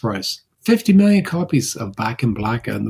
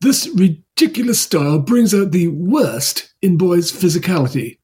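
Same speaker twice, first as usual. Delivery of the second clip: strict British headmaster; 5 semitones higher than usual. Pretend. British accent as a strict headmaster scolding boys' haircuts. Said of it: "Can you do it in a British accent, though? Like a, a strict, uptight".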